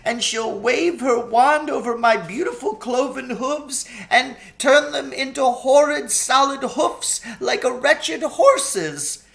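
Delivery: a faun crying as he lists his punishments; tearful faun. A narrator's reading. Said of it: "beginning to cry again".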